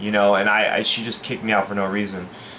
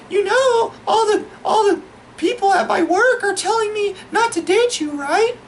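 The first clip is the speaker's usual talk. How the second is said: very high falsetto date impression; mock-feminine falsetto, rising to a question; girl voice. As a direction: imitate a girl confiding in squeaky falsetto. Acting out his date talking.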